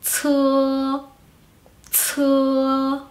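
"tsu" as puffed ts plus long uh; aspirated CE. Shown as a demonstration. Teacher adding a vowel to C, "tsu" said as exhaled tsuh. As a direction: hold "tsu" level as ts-uh with air. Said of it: "Después la C y la U sería".

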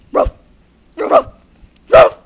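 short, sudden mimicked barks; dog bark imitation. Acting out something about a dog, barking. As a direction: bark like a dog in separate bursts.

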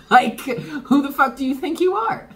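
high, laughing mock outrage; laughing disbelief. Scoffing at a busybody who meddled, high laughing disbelief.

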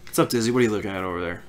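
low, quiet deadpan questions; dry prickly grumpy voice. Retold or imitated. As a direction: ask it dry and grumpy, like Cactus. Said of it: "I'm talking like Cactus".

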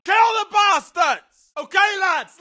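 usual speaking voice

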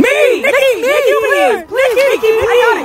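high, loud, pleading calls of Nikki; begging excitedly. Acted. Fan begs Nikki for the microphone, a high eager plea. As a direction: beg for the mic like a superfan. Fans beg for the mic.

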